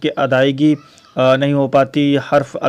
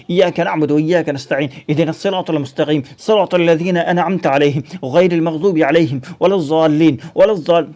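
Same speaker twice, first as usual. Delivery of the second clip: rushed memorising. Shown as a demonstration. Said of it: "ہم یاد کیسے کرتے ہیں".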